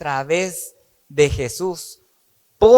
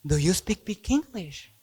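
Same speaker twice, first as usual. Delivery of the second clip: startled commander in broken English; 2 semitones higher, 4 dB quieter than usual. Acted. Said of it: "¿qué le responde?".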